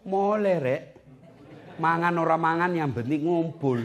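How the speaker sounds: put-on a homely Javanese village accent, laughing; Javanese folksy voice. Preacher mimicking a homesick Javanese migrant, folksy and amused. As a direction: say it like an East Java elder, with a chuckle.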